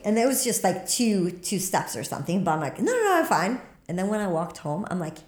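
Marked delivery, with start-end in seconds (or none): 2.8-3.6 high, loud brushing it off; quick high-pitched denial; insisting i'm fine. Retold or imitated. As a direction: wave off the scare, voice jumping up. Insisting after slipping.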